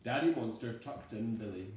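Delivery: ordinary delivery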